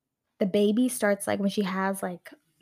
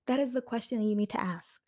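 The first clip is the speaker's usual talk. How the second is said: normal talking voice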